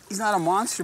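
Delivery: usual speaking voice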